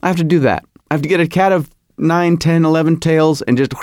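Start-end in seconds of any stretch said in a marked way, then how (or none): none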